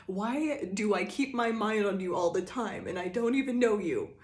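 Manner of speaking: high, loud pining whine; dramatic lovesick whine. Retold or imitated. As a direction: ham up the lovesick longing. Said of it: "They're like".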